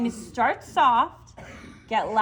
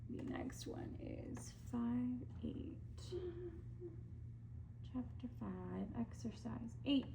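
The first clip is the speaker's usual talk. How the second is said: whispered aside; 2 semitones higher, 19 dB quieter than usual. Real, not acted. Reading from warm-up book.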